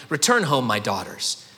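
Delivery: low, falling plea sinking on daughters; weary plea. Acted. Naomi sends her daughters-in-law home, low and weary. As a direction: sigh it out low as worn-out Naomi.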